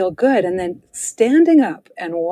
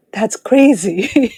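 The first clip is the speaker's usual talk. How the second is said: slow, louder, laughing disbelief; amused disbelief; 3 semitones higher, 5 dB louder, much slower than usual. Retold or imitated. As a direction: stretch it out through a laugh, retelling your own thought. Voices own incredulous thought, laughing.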